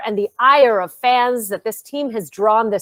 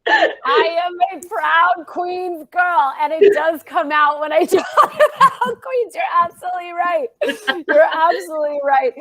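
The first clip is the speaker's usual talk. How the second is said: laughing; 6 semitones higher than usual. Real, not acted.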